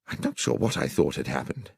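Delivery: plain reading voice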